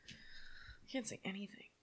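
soft unvoiced whisper. Real, not acted. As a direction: whisper it under your breath. Whispered aside.

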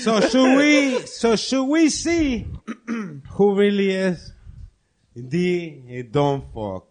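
slow, drawn-out suspense line; milk the suspense like a game host; dramatic host. Pretend. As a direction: quiz host teasing the big question. Dramatic quiz-show buildup.

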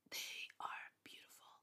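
a soft, awed whisper; breathy whisper. Real, not acted. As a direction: hush it like a secret treasure. Gushing about their clothes.

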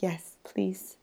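breathy pleading whisper. Retold or imitated. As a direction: whisper it like begging for sun. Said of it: "And we're like".

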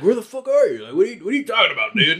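high, fast confused shout; bewildered, rushing the question; confused stepdad. Retold or imitated. Stepdad woken up, high and fast confusion. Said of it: "he's like".

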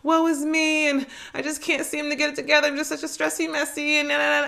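high, mocking pity-party voice; mock whining. Mocking listeners who complain, high whiny parody. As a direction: parody a self-pitying whiner, pitched high. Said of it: "if you out here just".

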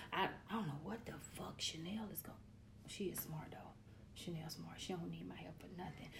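hushed unvoiced whisper; whispered aside. Real, not acted. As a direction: lean in and whisper it. Whispered aside about Chanel.

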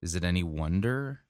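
plain reading voice

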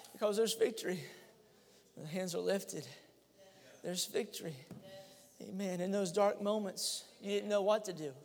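ordinary delivery

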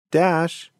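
high call of a name. A narrator's reading. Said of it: "Missus Brownfur called out".

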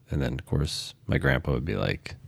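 ordinary delivery

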